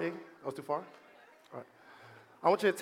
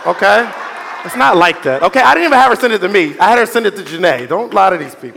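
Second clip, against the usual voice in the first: loud, half-laughing defence; loud laughing protest; 2 semitones higher, 16 dB louder than usual. Real, not acted. Host to audience about the bikini photo, loud laughing protest. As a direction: cut in loud to clear your name, laughing.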